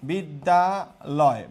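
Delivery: বিদ্যালয় very slowly, a gap after each syllable. Shown as a demonstration. Teacher showing class the syllables, বিদ্যালয় slowly broken up.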